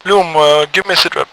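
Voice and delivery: loud radio call-out. Acted. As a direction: call the unit over the net. Leader on radio.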